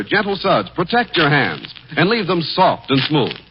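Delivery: normal talking voice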